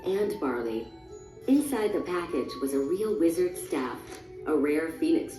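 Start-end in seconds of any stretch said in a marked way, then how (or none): none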